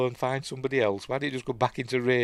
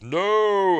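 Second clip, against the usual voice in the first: high, loud shout of refusal; anguished cry; 11 semitones higher, 5 dB louder than usual. Retold or imitated. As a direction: cry out as you fight it off. Priest fights off demon, cries out.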